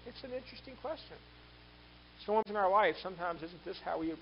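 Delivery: normal talking voice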